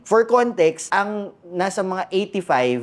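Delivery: normal talking voice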